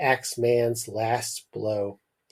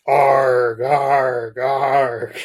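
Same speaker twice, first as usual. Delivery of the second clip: loud anguished arg cries; screaming; 6 dB louder, much slower than usual. A narrator's reading. Boy screaming as the train bears down, loud cries. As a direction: howl out in pain. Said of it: "barking howl filled the air".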